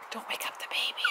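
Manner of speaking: hushed unvoiced whisper; whispering over a crib. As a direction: whisper so the baby stays asleep. Pretend baby asleep, hushing.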